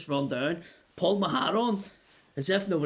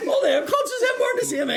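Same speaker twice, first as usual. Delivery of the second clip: very high laughing retort; high mock reply, laughing all through; laughing squeal; 5 dB louder than usual. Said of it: "And I was like".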